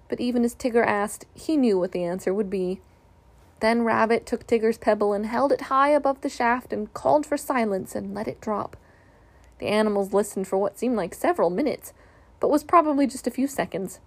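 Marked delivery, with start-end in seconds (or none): none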